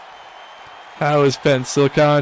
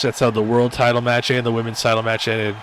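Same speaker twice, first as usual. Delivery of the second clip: ordinary delivery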